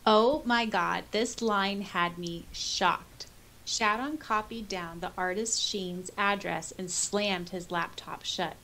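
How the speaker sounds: usual speaking voice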